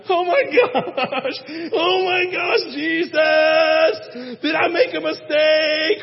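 high, loud panicked cry to Jesus; a man panicking the morning he proposes, crying out loud and high. Retold or imitated. Retold panic before proposing.

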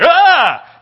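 loud, high sudden shriek; short shocked scream; startled scream. Acted. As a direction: jump out of your skin. Ghost suddenly appears, startled.

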